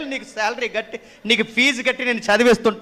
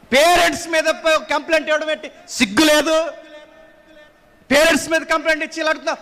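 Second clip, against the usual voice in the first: indignant rebuke; 4 semitones higher than usual. Acted.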